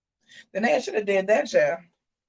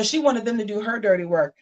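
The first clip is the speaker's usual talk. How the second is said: ordinary delivery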